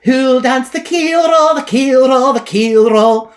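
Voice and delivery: high, loud Scottish lilt on each roll; Scotch accent. Acted. The end of the world calls out in a Scottish accent. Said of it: "with a Scotch accent".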